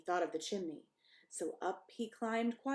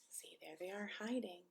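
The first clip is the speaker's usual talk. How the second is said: whisper; 9 dB quieter than usual. Real, not acted. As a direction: whisper it like a secret. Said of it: "whisper aside to children".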